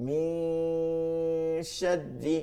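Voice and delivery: মিন শাররি with the n drawn out very slowly through the nose; nasal ghunnah. Shown as a demonstration. Teacher drilling ghunnah in a recitation, slowly. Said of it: "গুন্নাই একটা গুন্না".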